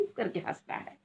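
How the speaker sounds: hushed breath, the allowed way to laugh; barely audible stifled laugh; whispered laugh. Shown as a demonstration. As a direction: laugh without any voice. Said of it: "हा करके नहीं".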